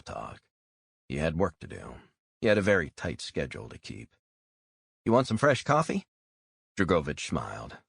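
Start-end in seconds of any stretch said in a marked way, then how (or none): 5.0-6.1 higher, brighter-pitched waitress voice; a chirpy diner waitress checking on a customer; friendly waitress. Acted. Waitress asks a tired customer if he's okay, friendly voice.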